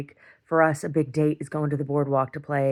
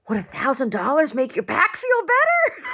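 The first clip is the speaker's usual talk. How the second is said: much higher, mocking sarcastic offer; sarcastic retort; 10 semitones higher, 4 dB louder than usual. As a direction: dangle the money back at him, teasing. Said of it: "I'm like".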